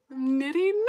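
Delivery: high, loud mock-innocent reply; retold startled answer. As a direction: blurt it out as if caught off guard. Startled answer to police officer.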